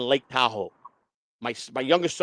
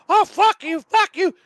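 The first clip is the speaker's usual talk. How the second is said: loud, very high squealed cursing; High Pitch Eric; 7 dB louder, slower than usual. Retold or imitated. Impersonator cursing at a prank target, loud high-pitched voice. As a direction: curse in a piercing put-on squeal. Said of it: "So I did it with him yesterday".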